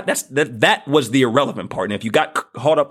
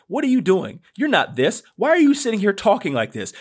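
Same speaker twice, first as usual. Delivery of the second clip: higher-pitched retold disbelief; mimicked doubters; 7 semitones higher than usual. Family and friends challenging his posting, retold in higher-pitched disbelief. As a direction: imitate friends questioning his sanity.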